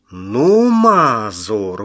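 wife crying out in mock outrage; indignant outcry. A narrator's reading. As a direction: protest at him, loud and offended. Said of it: "возмущённо завопила она".

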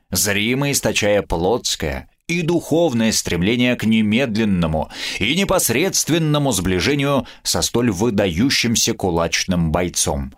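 plain reading voice

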